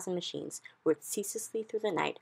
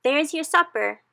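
much higher, much louder taunting shout; gleeful shout; 11 semitones higher, 10 dB louder than usual. A narrator's reading. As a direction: hurl it down the pit. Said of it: "he shouted".

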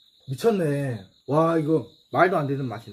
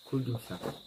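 quiet; 3 semitones lower, 11 dB quieter than usual. Real, not acted.

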